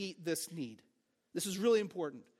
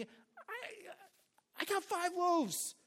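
humble confession; 8 semitones higher, much slower than usual. Acted. Voicing a believer confessing need.

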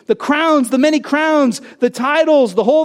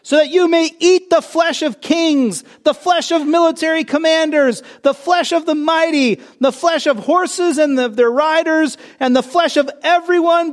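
high, slow proclaiming angel call; loud call; 7 semitones higher than usual. A narrator's reading. Preacher reading scripture, angel calling loudly to the birds. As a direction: read it like an angel crying out over the birds. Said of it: "he called out in a loud voice".

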